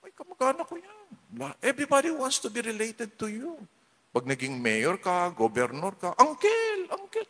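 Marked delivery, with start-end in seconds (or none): 0.1-1.0 a hint of a fawning relative. Relatives claiming the rich one. 6.2-7.3 very high, sing-song calling of uncle; fawning relative. Retold or imitated. Relatives fawning over a mayor.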